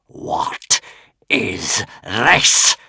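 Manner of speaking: rasping, half-breathed dragon menace; a savage hiss in the mind; savage dragon thought. A narrator's reading. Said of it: "those thoughts were savage".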